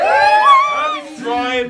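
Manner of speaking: high, slow shouted reveal to the class; drawn-out shout. Shown as a demonstration. Museum guide shouting out to the class, high and slow. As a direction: stretch it out, loud and high, like a showman. Mangle demo with children.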